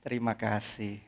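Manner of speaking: very quiet, low thank-you; soft thanks. Retold or imitated. Juan thanking the guard very softly. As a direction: murmur thanks sincerely. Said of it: "ngomong apa".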